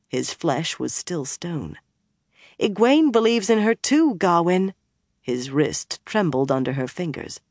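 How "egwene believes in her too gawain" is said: high, insistent appeal; urgent pleading. A narrator's reading. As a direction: beg him to listen, voice raised in pitch. Min pleads with armed Gawain.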